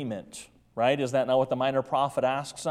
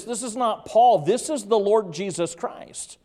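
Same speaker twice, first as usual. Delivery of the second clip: raised, emphatic preacher's cry; preaching at full force; 5 semitones higher, 5 dB louder than usual. Acted. Preacher proclaiming Christ's authority to church, forceful. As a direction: thunder it out to the congregation.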